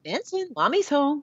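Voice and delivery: high sing-song pet talk; little cat voice. Retold or imitated. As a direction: coo it like talking to your cat. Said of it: "do you talk in little cat voice".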